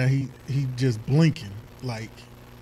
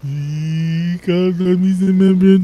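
loud, slow mocking rant; 5 semitones higher, 13 dB louder, slower than usual. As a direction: drag it out loud to mock. Mocking a crying man.

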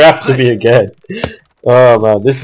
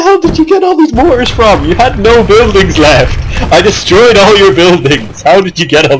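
outraged disbelief; 8 semitones higher than usual. Real, not acted. Outraged at enemy surviving.

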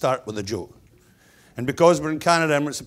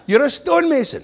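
high, loud mock exasperation; hammed-up fatherly verdict; emphatic insistence; 14 semitones higher, 8 dB louder than usual. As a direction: declare it like a fed-up dad.

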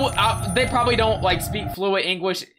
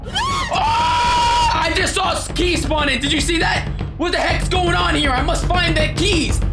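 high frightened shout; startled scream; screaming in fear; 7 semitones higher, slower than usual. Real, not acted. Startled by key spawn.

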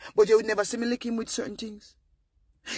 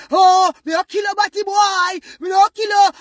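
mock furious threat; 11 semitones higher, 11 dB louder than usual. Damaged adult threatening over a quarrel, shouted rage. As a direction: yell like someone about to pull a knife.

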